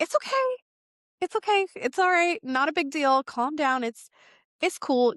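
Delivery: calm him down kindly; gentle reassurance. Retold or imitated. To the forgetful spirit animal, gentle reassuring voice. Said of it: "you went easy on him".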